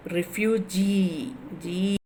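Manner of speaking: refugee slowly, its final GEE held long, then repeated alone; prolonged last syllable. Shown as a demonstration. Teacher showing last-syllable stress, drawing out the end of refugee. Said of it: "So you are prolonging".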